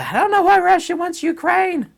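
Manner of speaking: high, laughing mock-naive voice; mocking clueless commenters. Mocking online commenters who ask why Russia wants Ukraine, high and laughing. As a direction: play dumb with a laugh. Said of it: "I can't believe people say".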